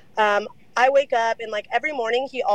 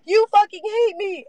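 much higher, whiny imitation of him crying; mock crying whine; 10 semitones higher, slower than usual. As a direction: mimic a boyfriend sobbing a guilt trip, pitched way up. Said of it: "crying and going".

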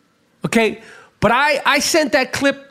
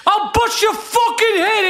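furious shout; 8 semitones higher than usual. Acted. Ranting at imagined Nazis.

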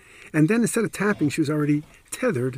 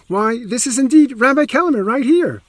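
much higher and louder know-it-all bystander; eager announcement; 10 semitones higher, 9 dB louder than usual. Retold or imitated. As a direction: blurt it out proudly for all to hear.

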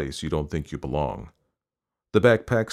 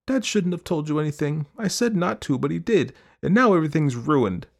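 put-on upset girl; 10 semitones higher than usual. A narrator's reading. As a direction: protest to your mother, building to the last word. Girl's upset outburst at mother.

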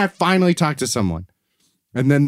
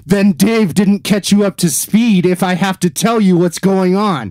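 loud, higher-pitched exasperated retort; angry outburst; 5 semitones higher, 5 dB louder than usual. Retold or imitated. Customer made to explain the issue to each agent, angry. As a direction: raise your voice at useless customer service. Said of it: "I'm so fucking angry at these people".

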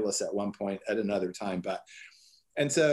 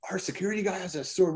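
a higher, thrilled exclamation; amazed delight; 7 semitones higher, faster than usual. Retold or imitated. Hearing about the sword, thrilled and amazed. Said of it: "we were like".

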